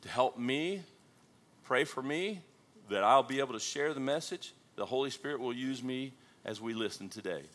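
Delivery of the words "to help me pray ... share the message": usual speaking voice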